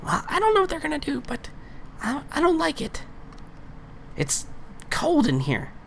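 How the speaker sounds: very high, tiny voice, quick to start; high-pitched creature. Acted. Unseen creature complains of the cold, high-pitched. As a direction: squeak out the complaint. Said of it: "I think she goes".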